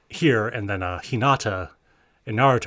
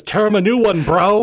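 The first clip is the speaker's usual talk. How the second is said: mock hype yell, higher and louder; Tanaka yelling; 9 semitones higher, 8 dB louder than usual. Friend imitates Tanaka cheering a server, in a higher, louder mock yell. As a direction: bellow it like a rowdy teammate cheering.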